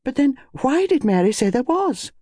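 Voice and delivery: high-pitched, rallying objection. Acted. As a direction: come back at him with renewed insistence. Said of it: "Rallying, she went on".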